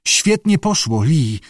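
plain reading voice

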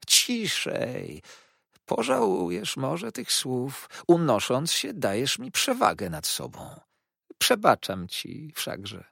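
high, slow, oily hushed voice; soft slow Uriah. A narrator's reading. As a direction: hush him with false calm. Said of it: "mówił cały czas cicho i zwolna". (cichy, powolny, obłudny głos)

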